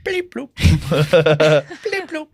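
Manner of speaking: silly bleep-bloop noises with laughs; Clangers-like bleeps. Pretend. Host mimics the Clangers for co-hosts, playful bleep-bloop voice. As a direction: mimic tiny moon creatures beeping. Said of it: "is it the clangers?".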